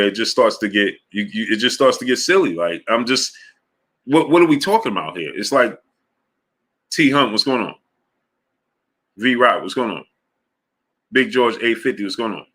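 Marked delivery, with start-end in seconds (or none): none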